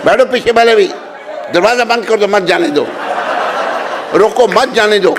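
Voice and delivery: half-laughing call to keep people seated. Real, not acted. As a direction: call out so the back of the hall hears. Calling out to audience at back.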